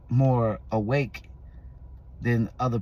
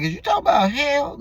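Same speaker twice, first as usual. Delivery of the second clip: much higher, incredulous, a bit louder; high startled is-you-talking-about-hell; mock alarmed question; 4 dB louder than usual. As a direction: ask it in squeaky disbelief. Retelling his alarmed dream question.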